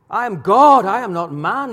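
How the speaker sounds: loud, emphatic proclamation in God's words; God declaring. Retold or imitated. Preacher voicing God to his rebellious people, loud and declaring. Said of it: "But God doesn't talk like that".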